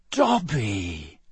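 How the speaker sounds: slow, drawn-out disbelief; incredulous. A narrator's reading. Harry calls to a creature he thinks he knows, incredulous. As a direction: stretch the name out in disbelief. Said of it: "said Harry incredulously".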